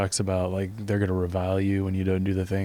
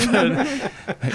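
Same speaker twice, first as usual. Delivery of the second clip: laughing; 5 dB louder than usual. Real, not acted. Laughing at his own Noah joke.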